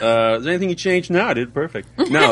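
high, fast, smug mock boast; vain and self-admiring. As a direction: brag with a grin in the voice. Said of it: "I sound so conceited".

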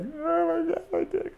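very high, soft mock whimper; crying and panicking. Reenacting himself alone in the shower, high mock crying panic. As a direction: squeal pitifully, as if sobbing. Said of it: "fucking crying and panicking".

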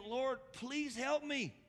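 slow, high-pitched begging; pleading. Retold or imitated. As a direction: stretch out a desperate appeal. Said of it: "pleading again".

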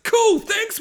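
very high mock-cheerful thanks; sarcastic thanks. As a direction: spit out fake gratitude. Friends sarcastic at Woody.

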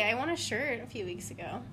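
ordinary talk, nothing marked

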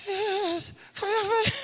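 feeble dying voice. Retold or imitated. Dying man answers weakly.

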